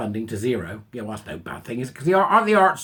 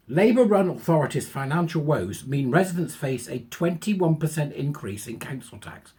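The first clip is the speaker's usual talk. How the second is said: ordinary delivery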